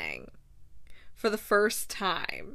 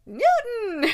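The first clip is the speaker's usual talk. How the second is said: high, loud mock yell of a name; calling out; 13 semitones higher, 7 dB louder than usual. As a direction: shout the dog's name in alarm. Edwina calls the dog.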